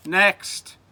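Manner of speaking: high, loud official shout; yelled dismissal. Acted. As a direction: yell for the next pupil. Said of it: "just yelled".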